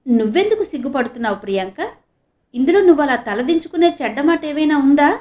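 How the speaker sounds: anxious, pressing question; mildly flustered and worried. Acted. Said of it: "గాభరా పడుతూ అన్నాడు ఆనంద్".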